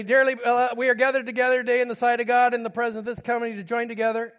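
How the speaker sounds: loud, fast wedding-liturgy rattle; hurried officiant. Retold or imitated. Youth pastor rushing through a surprise wedding, loud and fast. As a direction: rush through the vows like a pastor caught off guard. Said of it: "and I say".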